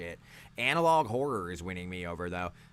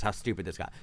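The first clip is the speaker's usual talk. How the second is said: usual speaking voice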